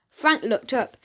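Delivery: usual speaking voice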